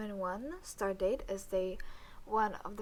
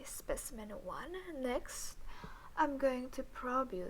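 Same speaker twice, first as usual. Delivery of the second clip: usual speaking voice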